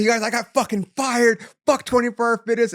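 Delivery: high, loud announcement yell; retold yell to gym members, high and loud; shouting to the gym. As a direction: call it out to the whole gym floor. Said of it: "I went out guns blazing".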